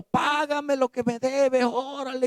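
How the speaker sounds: a servant choking his debtor, demanding payment; harsh demand. Acted. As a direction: squeeze the debt out of him. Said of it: "le ahogaba, diciendo".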